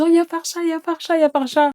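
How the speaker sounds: high, repeated excited chant; excited siblings. Retold or imitated. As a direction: imitate children spotting their sister. Eux, ils sont en pétard. (voix aiguë d'enfants surexcités)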